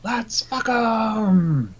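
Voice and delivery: high, drawn-out parody of a catchphrase; Strife. Retold or imitated. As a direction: echo the hammy villain, stretched out. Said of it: "versus Strife, who's just like".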